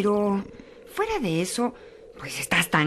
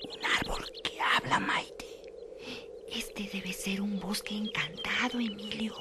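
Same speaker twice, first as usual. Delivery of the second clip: hushed, amazed whisper; awed whisper; 11 dB quieter than usual. Acted. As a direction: whisper in wonder. Awed whisper about talking tree. (susurro asombrado)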